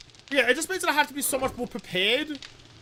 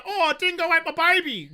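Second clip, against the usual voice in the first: higher-pitched mock wail of a joke; mocking dingo joke; 7 semitones higher, 3 dB louder than usual. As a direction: toss it off as a mocking catchphrase. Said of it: "everyone in the world made ... jokes".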